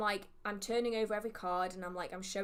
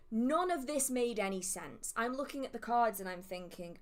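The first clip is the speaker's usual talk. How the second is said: usual speaking voice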